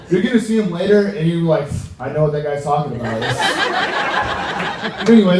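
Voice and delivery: ordinary talk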